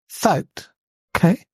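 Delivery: ordinary talk, nothing marked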